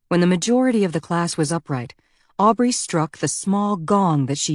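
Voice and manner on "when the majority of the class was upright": ordinary delivery, nothing marked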